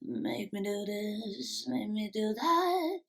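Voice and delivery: slow, hushed, menacing imitation of the singer; menacing evil tone. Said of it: "that kind of menacing, evil tone".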